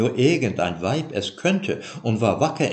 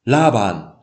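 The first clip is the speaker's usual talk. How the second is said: Laban called out loud and falling; calling out Laban; 3 semitones higher, 8 dB louder than usual. A narrator's reading. Jakob storming out, calling loudly for Laban. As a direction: call his name across the house. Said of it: "rief er".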